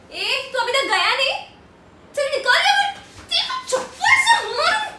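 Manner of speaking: loud, building to a high yell; angry threat. Acted. Woman to lingering harasser, threatening slipper beating, high shout. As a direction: chase him off with a threat.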